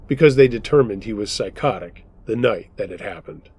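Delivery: ordinary delivery, nothing marked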